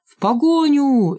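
high, drawn-out rallying shout; calling after them. A narrator's reading. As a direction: send the gang chasing after them. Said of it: "Они кричали нам вслед". (крича вслед)

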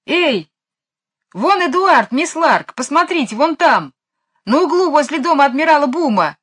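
высокий громкий крик; кричит через улицу, указывая. A narrator's reading. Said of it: "закричал Майкл". (Майкл кричит мисс Ларк, громко и высоко)

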